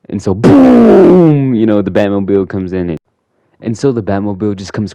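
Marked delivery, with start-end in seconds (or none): 0.3-1.5 long, loud booming "boom"; imitated explosion. Batmobile bursting in, long loud imitated boom. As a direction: stretch out a big crash noise.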